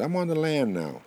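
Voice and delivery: loud, high-pitched answer; calling back. Acted. Buffalo answers the porcupine in his stomach, calling back. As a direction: holler the progress report, voice up high.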